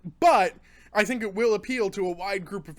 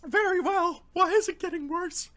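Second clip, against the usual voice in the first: high, distressed whine; pained whine; 11 semitones higher than usual. Real, not acted. Reviewer asking why the burn worsens, high pained whine. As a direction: pitch shoots up as the burn climbs.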